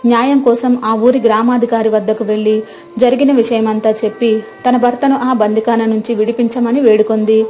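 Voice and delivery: usual speaking voice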